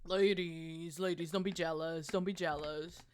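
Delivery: sultry mock brag; teasing. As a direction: purr it to make them jealous. Teasing chat.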